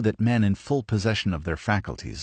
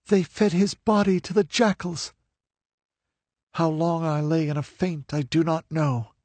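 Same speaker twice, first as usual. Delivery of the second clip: high, earnest telling of her story; a captive recounting her loss; grieving young woman; 9 semitones higher than usual. A narrator's reading. Livia's voice in narration.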